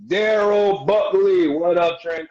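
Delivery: big, drawn-out, high greeting; loud shout-out. Real, not acted. Host greeting a listener on air, loud high shout-out. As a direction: holler the name like a hype host.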